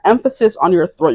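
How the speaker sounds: ordinary talk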